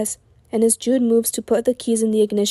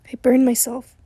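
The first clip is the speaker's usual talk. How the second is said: ordinary delivery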